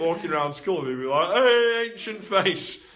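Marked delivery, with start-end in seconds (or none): 1.3-2.8 loud, high, mocking schoolkid call; mocking shout. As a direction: yell it across the hallway to tease him. Kids calling him names at school.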